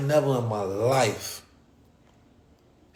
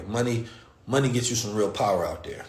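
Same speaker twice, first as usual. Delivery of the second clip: normal talking voice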